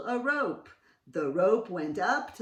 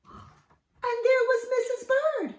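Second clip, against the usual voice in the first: high, loud gasping surprise; surprised gasp; 7 dB louder than usual. A narrator's reading. Narrator revealing Missus Bird to children, high and surprised. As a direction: gasp as if she's really there.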